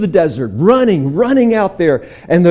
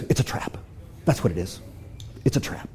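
suspicious hush; 6 semitones lower, 11 dB quieter, faster than usual. Acted. King suspicious.

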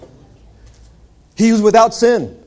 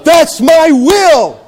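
slow, loud proclaiming voice of God; 7 semitones higher, 4 dB louder, slower than usual. Acted. Preacher voicing God's will to the church, slow and loud. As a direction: declare it slowly, booming. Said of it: "Look at the heart of God".

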